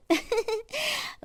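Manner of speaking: high soft giggling laugh; daughter-in-law giggles to butter up her mother-in-law; coy giggle. Acted. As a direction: giggle sweetly before asking a favour. Coy giggle before asking.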